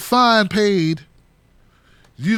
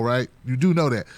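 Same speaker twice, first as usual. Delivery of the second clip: ordinary delivery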